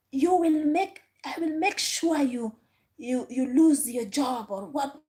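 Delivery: mimicking a furious passenger's shouted threat; imitating a yelled threat. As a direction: replay her threat as a hammed-up yell. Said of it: "she yelled".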